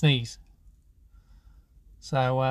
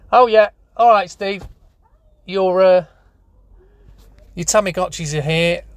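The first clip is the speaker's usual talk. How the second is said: loud cheery supplier; 3 semitones higher, 7 dB louder than usual. Pretend. Supplier announcing good news.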